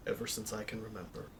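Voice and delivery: very soft, quick character reply; hushed, understated in-character answer. Acted. Player as B answers about Emily, very soft character voice. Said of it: "his canon character voice".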